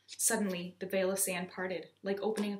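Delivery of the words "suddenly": usual speaking voice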